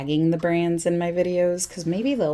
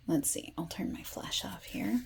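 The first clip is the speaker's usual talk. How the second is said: whispering; 11 dB quieter than usual. Real, not acted. Whispered aside turning off flash.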